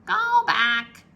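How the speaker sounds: high, loud parrot call. A narrator's reading. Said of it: "squawked Polly".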